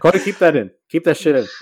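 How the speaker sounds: loud, laughing order to the editor; laughing call-out. Real, not acted. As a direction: shout it through a laugh.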